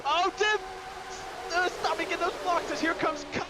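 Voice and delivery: high, urgent yelling at a driver; excited shout. Real, not acted. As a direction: call it out in alarm. Live race call, driver blocking.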